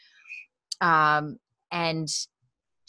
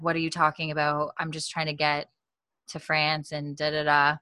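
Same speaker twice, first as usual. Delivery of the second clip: ordinary delivery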